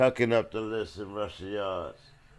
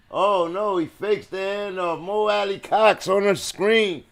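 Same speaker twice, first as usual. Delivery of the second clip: loud, high burst of surprise; excited play call; 8 semitones higher, 6 dB louder than usual. Real, not acted. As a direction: call the fake as it springs open. Live call of a trick play.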